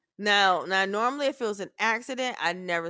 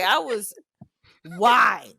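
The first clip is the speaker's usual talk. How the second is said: ordinary talk, nothing marked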